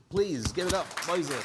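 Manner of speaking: ordinary delivery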